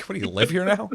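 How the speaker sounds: high, laughing incredulous question; mock-exasperated, on the edge of laughter; retold teasing disbelief.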